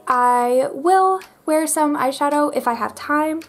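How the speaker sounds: usual speaking voice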